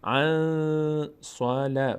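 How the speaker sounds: "عن صلا" with a long nasal hum before the s; hidden nun. Shown as a demonstration. Teacher shows ikhfa before sad, hidden nun. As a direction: slowly hold the nasal N of "عن", then open "صلا".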